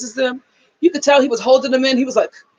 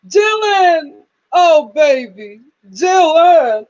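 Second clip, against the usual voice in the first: mock wail; 4 semitones higher, 6 dB louder, much slower than usual. Imitating crying relative.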